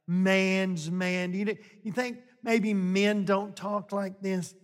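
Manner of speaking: ordinary delivery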